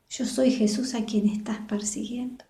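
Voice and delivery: a whisper, the voice of Jesus answering; susurrando. Acted. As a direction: breathe it out softly as Jesus. Jesús responde a Saulo. (Jesús le responde a Saulo en un susurro)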